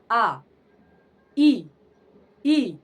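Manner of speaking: आ ई ई called out loud and long, one by one; vowel demo. Shown as a demonstration. Teacher to pupils, sounding out आ ई ई loudly. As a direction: sound out आ, ई, ई clearly for the class. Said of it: "दो प्रकार की होता है".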